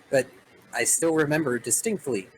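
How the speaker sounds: ordinary talk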